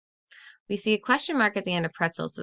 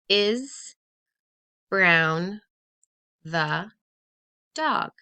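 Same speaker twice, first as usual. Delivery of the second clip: each word read out alone, Brown and dog punched; 5 dB louder, much slower than usual. Shown as a demonstration. Teacher reading jumbled words to class, word by word. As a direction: read the words one at a time, slowly. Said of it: "let's look at those words again".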